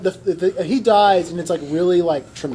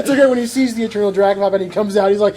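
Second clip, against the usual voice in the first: normal talking voice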